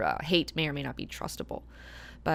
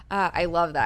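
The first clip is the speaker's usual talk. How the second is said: normal talking voice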